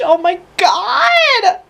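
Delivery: high, slow, half-shouted; laugh-cry outburst. Real, not acted. Fan overwhelmed by a heroine's sacrifice. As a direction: let it burst out through tears.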